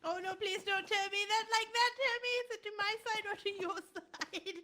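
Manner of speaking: loud, high laughing panic; panicked laughing. Real, not acted. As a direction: laugh through your panic.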